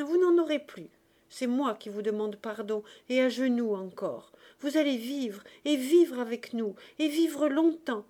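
loud, insistent pleading with pitch surging up; impassioned exclaiming. A narrator's reading. Marius urging the old man to live, loud and fervent. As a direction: hammer home each promise of life. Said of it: "s'écria Marius".

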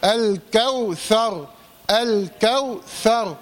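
الكوثر slow, the lam clearly voiced; clear lam in al-Kawthar. Shown as a demonstration. Teacher drilling الكوثر slowly for the student. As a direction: sound the lam of الكوثر fully. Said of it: "خلي بالك تظهر اللام".

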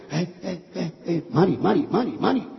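hushed, repeated little nudges; cautious soft calling. Retold or imitated. Husband creeping up to his crying wife, calling softly. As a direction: call a name softly to get attention. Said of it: "me acerqué despacito y le dije".

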